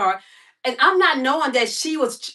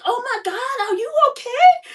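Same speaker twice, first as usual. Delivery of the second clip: high, crying alarm; panicked concern; 10 semitones higher than usual. Retold or imitated. Woman rushing to the fallen stranger, high panicked cry. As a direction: cry out as if she's hurt.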